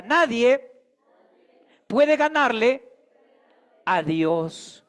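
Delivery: slow, spaced words for the congregation to echo; rising call, then settle; call to repeat. Shown as a demonstration. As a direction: lead the church in saying it back. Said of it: "Repita conmigo. Diga".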